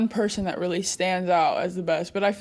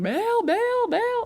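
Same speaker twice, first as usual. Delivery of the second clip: much higher drawled name, repeated like a coach's call; Coach Green impression; 12 semitones higher than usual. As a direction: mimic a Southern coach calling a player over and over. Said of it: "impersonate Coach Green".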